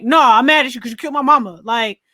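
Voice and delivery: ordinary talk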